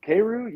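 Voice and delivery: "Karu" said KAY-roo, pitched high; pronunciation check. Shown as a demonstration. Host checking the name with his guest, "Karu" as KAY-roo. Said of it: "is it, is it pronounced".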